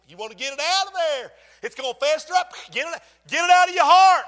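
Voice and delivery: high, loud, building exhortation; loud, urgent pulpit cry; full-force preaching. Acted. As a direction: build to a shouted command.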